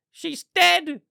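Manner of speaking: hammed-up shrill fan wail; hysterical fan. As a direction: play a fan losing it over a leaked photo. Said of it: "I saw some people say".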